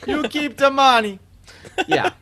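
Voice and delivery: high-pitched cartoon Italian accent; Oscar from Hey Arnold. Player mimicking a cartoon Italian for laughs, Oscar impression. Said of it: "It was just Oscar from Hey Arnold".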